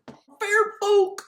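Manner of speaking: very high, loud cartoon exclamation; mock cartoon cry. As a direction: squawk the name in a silly put-on voice. Mock cry repeating the name.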